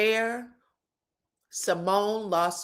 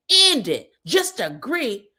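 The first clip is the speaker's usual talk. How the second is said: imitating Angel; 4 dB louder, slower than usual. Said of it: "this is Angel".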